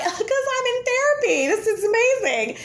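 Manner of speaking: high, bubbly, delighted tone; answering strangers about therapy, high and bubbly; gushing reply. Retold or imitated. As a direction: beam it out like it's obvious. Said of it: "I'm like".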